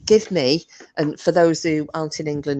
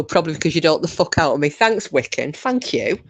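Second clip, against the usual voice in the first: ordinary talk, nothing marked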